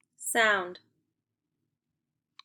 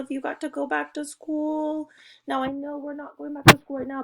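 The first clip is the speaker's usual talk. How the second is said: higher, louder, excited teacher voice; playful excitement; 5 semitones higher, 5 dB louder than usual. Pretend. Teacher asking pupils to show excitement about school, playfully excited. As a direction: act thrilled for the pupils.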